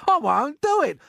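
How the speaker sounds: high, loud protest; defiant refusal. A narrator's reading. Earthworm refusing to be seagull bait, defiant. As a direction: refuse outright at full voice.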